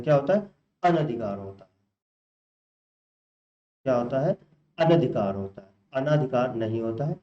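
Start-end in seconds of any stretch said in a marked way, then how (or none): none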